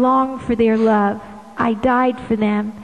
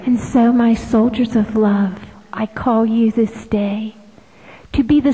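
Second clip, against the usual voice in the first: hushed whisper, spoken close; whispered call. Acted. Jesus calling his soldiers of love, hushed whisper. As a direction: draw them in with a secret whisper.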